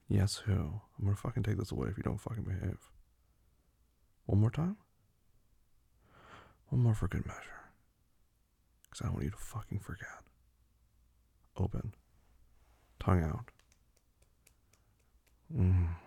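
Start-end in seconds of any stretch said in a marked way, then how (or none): none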